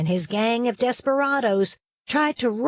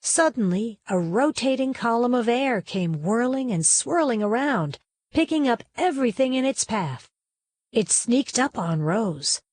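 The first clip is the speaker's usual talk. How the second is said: ordinary delivery, nothing marked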